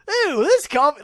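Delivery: high, loud hammed-up crazy voice; crazy cop voice. Imitating the crazy cop tasting his coffee. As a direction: squeal it like a lunatic cop. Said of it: "he's got the craziest voice".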